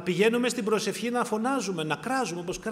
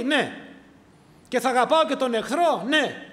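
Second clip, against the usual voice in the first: louder and higher, each promise pushed harder; loud, rising list of vows; mock promises; 5 semitones higher, 7 dB louder than usual. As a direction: preach the believer's eager vows, building in force. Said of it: "και εγώ λέω".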